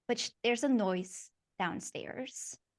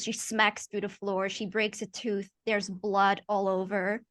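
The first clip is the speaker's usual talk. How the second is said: normal talking voice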